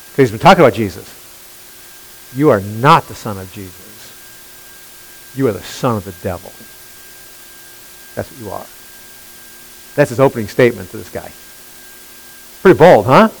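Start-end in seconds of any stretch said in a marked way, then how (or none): 2.3-8.7 low, hushed correction; quoted rebuke, deep and subdued; quiet stern rebuke. Acted. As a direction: drop low and let it fade softer. Acts Saul's denial to Elymas.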